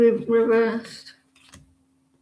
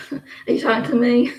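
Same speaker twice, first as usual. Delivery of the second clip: usual speaking voice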